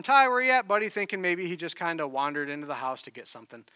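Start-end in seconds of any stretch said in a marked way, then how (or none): 0.0-0.9 put-on raised, higher call for a missing child. Dad searching round the house for his son, calling out. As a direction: call out for your little boy, louder and higher. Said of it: "calling for him".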